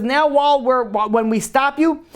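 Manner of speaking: high-pitched, drawn-out incredulous question; retold indignant challenge, slow and high; incredulous soldier. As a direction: challenge him in disbelief, pitch jumping up. Soldier confronting the crying smuggler.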